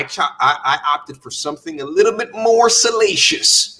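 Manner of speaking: ordinary talk, nothing marked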